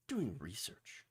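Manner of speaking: whispered, as if marvelling; mock-awed whisper. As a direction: whisper it like an unthinkable idea. Mocking their lack of research.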